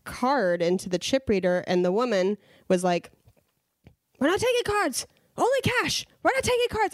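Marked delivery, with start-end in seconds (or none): 4.2-6.9 high-pitched cashier's yell, no real volume; imitated yelling. Cashier to customer with a card, high imitated yelling. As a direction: snap at a customer in a high mock shout. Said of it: "she, like, yelled at me".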